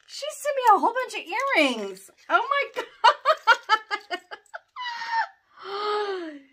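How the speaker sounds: high, loud, breaking into laughter; thrilled, laughing high shriek; overjoyed squeal. Real, not acted. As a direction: squeal with delight at a surprise gift. Overjoyed at bunch of earrings.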